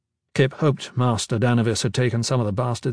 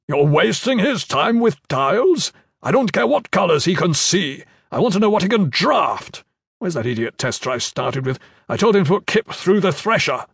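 commander; 6 semitones higher than usual. Acted. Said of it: "Commander Iron Fist stepped in".

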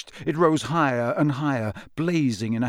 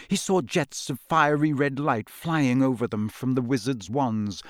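plain reading voice

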